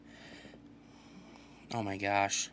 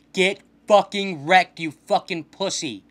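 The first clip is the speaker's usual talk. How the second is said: drawn-out trash-talk shout; 4 semitones higher, 8 dB louder, slower than usual. Real, not acted. Trash talk after win.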